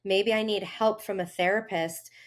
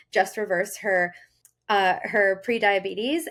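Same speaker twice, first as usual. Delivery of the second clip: ordinary talk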